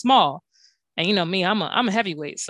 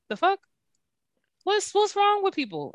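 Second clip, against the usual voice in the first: higher, disbelieving complaint; exasperated; 9 semitones higher than usual. Real, not acted. Host fed up with strangers' rude messages, exasperated. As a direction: throw up your hands at people.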